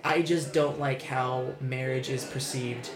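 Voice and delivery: ordinary delivery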